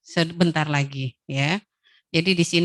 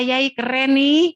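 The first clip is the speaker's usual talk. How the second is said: put-on slow, loud cheerful praise for the child; delighted praise, higher and louder; praising; 5 semitones higher, 6 dB louder, slower than usual. Shown as a demonstration. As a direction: cheer the kid on, drawn out. Mother praising child's progress.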